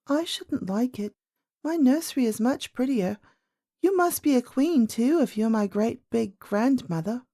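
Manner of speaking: put-on high little-girl voice, frank; little princess. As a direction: play a candid young princess. Princess speaks to old lady.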